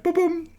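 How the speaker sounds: high imitated musical booms; mock soundtrack boom. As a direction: sing out the soundtrack beat. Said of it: "And it'd be like".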